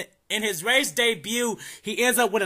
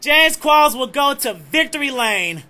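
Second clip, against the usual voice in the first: normal talking voice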